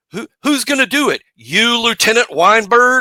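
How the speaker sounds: tossing off Jack's courtroom line with a bit of menace; Jack Nicholson impression. Retelling the movie to his co-host, a lightly voiced Nicholson line. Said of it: "big speech from Jack Nicholson".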